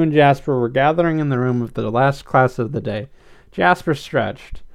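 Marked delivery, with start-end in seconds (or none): none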